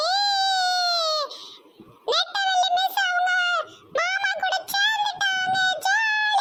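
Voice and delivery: high, drawn-out shout of joy; gleeful cheering. Acted. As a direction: cheer the good news like an excited kid. Child cheers the couple's reunion. (உற்சாகமாக இழுத்துக் கூவும் குரல்)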